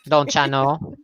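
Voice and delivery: folksy Minnesota lilt on the phrase; Minnesota accent. Shown as a demonstration. Showing off the accent Viper asked to hear, Minnesota accent. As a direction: do a teasing Upper Midwest accent. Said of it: "wants to hear your accent".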